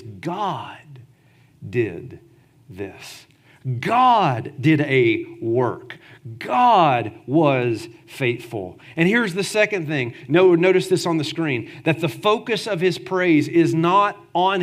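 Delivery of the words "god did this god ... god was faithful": reverent. Retold or imitated. What he's saying is.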